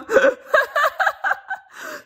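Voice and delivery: real high laughter. Real, not acted. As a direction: burst into shrieking laughter. Laughing at own impression.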